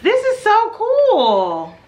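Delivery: high loud slow gushing; excited squeal. Real, not acted. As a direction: squeal with delight. Delight at gift.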